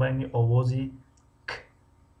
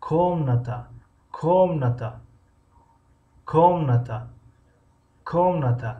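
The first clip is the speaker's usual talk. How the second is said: teacher drilling комната stressed on the first syllable; 3 semitones higher than usual. Shown as a demonstration. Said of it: "ударение дар ҳиҷои аввал аст".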